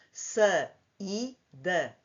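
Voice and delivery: "Sa-i-da" broken into three separate beats; teacher's split-syllable "Sa-i-da". Shown as a demonstration. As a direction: say "Sa-i-da" one syllable at a time, pausing between. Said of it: "pertencem a sílabas diferentes".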